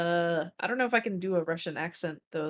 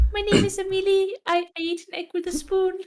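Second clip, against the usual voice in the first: higher, louder quoting voice; McElroy clip voice; 9 semitones higher, 6 dB louder than usual. Retold or imitated. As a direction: parrot the clip's squeaky delivery. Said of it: "fucking McElroy's clip".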